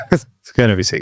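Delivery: said through a laugh. Real, not acted. Repeats it laughing.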